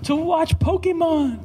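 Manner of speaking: put-on very high, whiny child voice; mock a complaining kid, stretching the last words; squealed protest in a put-on child's voice; imitated kid's yell. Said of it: "they'll just yell at me".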